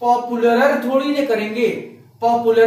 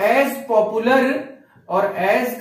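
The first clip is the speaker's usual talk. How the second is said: slow dictation; much slower than usual. Shown as a demonstration. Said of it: "teacher dictating the answer".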